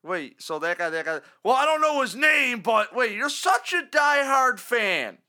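mock radio host. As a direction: skeptical host, sarcastic disbelief. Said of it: "John Murphy just hits the brakes and goes".

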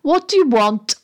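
lightly voiced grumpy doorkeeper; testy unseen voice. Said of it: "same voice as before, but not muffled now".

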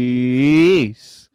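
calling out loudly. Real, not acted. Calling out to a viewer.

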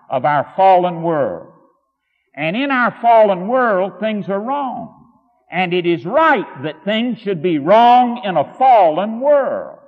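rising, insistent pulpit voice, hammering each point; preaching at full force. Acted. Preacher to his flock, building fervent preaching. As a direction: drive home the fallen world to the congregation. Preaches about a fallen world.

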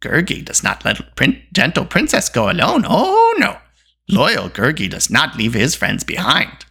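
sniffing, eager creature voice; little beast piping up, pitch swooping; Gurgi, proud then reproachful. A narrator's reading. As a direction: play a loyal creature, boasting then chiding. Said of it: "he added reproachfully".

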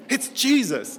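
put-on high-pitched thrilled child's cry; excited kids. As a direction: imitate kids going crazy with delight. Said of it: "the kids went crazy".

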